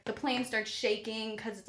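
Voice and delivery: usual speaking voice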